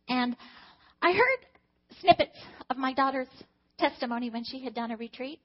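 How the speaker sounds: ordinary talk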